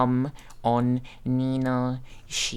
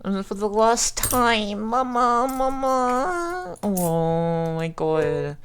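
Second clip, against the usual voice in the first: choked up; 9 semitones higher, slower than usual. Real, not acted. Mourning streamer's farewell.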